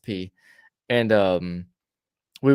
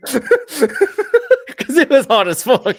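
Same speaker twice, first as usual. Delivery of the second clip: laughing; 12 semitones higher, 7 dB louder, much slower than usual. Real, not acted. Teller explains his punchline about getting wet, laughing. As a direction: talk through real laughter, high and loud.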